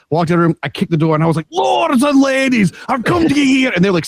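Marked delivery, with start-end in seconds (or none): 1.5-3.7 high, drawn-out shouted Scottish proclamation; big, broad Scottish herald's cry; big Scottish accent. Retold or imitated. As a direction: burst in bellowing in a broad, theatrical Scottish accent. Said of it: "that was a really good accent".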